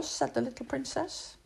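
usual speaking voice

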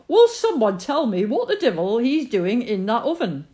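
high, loud exasperated outburst; angry father. A narrator's reading. Father finds a kitten in the oven, loud and exasperated. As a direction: bellow in disbelief at the oven.

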